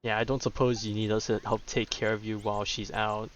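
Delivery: ordinary delivery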